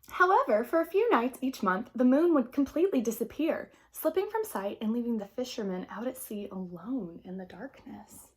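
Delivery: put-on gentle read-aloud to children; storybook narration. A narrator's reading. Teacher reading a picture book to the class, gentle narration.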